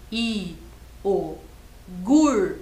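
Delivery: I, o, gur each said slowly as a separate beat; syllable by syllable. Shown as a demonstration. Teacher sounds out syllables of iogurte for children. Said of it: "Como que eu escrevo gur?".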